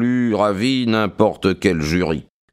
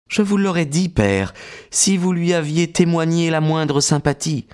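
put-on higher, resolute young woman's voice; firm young woman; 8 semitones higher than usual. A narrator's reading. Maud rebuking her brother William, firm young woman's voice.

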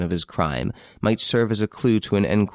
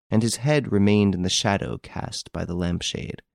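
plain reading voice

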